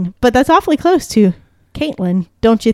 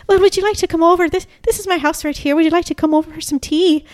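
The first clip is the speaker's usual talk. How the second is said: higher, lilting imitation Irish accent; Irish old lady; 7 semitones higher than usual. Old lady inviting strangers to tea, Irish accent. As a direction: put on a sweet Irish lilt, pitched up. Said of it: "most beautiful Irish accent".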